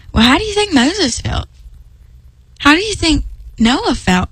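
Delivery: a hint of a friend asking deadpan; friend's deadpan question. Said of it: "this person looked at me".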